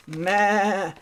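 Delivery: long, high "maa"; goat bleat. Acted. Goat greets little pig with a drawn-out bleat. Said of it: "Mister Goat".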